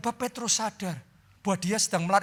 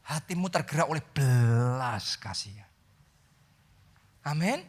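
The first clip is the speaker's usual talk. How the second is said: prayer; 7 semitones lower than usual. Acted. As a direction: pray it gently, drawing them in. Leads a prayer.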